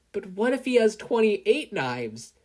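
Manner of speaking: mock worry. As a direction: worry dramatically. Worried joking question.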